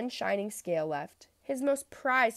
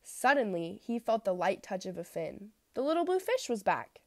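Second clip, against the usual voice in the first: ordinary delivery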